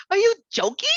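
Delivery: high, loud disbelief; retelling her own shocked outburst; are-you-kidding rise; incredulous. Retold or imitated. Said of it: "And I said that for sure".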